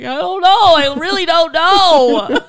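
high, loud, slow laughing wail; tearful wail. Retold or imitated. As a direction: wail it like a helpless crier. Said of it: "I'm like".

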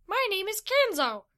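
loud, high squeaky greeting; small creature's high voice; high-pitched little creature. A narrator's reading. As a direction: pipe up like a tiny creature. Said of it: "said in a high-pitched voice".

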